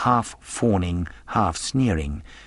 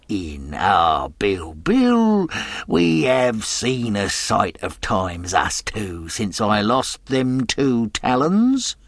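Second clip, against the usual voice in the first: leering, wheedling pirate voice; a fawning seaman with a hidden threat; sly old sailor; 3 semitones higher, slower than usual. Acted. Stranger voiced in read story.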